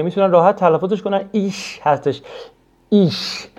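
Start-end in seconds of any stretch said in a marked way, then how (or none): 1.3-1.8 "ایش" with a soft sound between sh and kh. Shown as a demonstration. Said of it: "خیلی شی نرمی هستش". 2.9-3.5 "ایش" alone, ending in a soft sound between sh and kh. Shown as a demonstration. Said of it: "خیلی شی نرمی هستش".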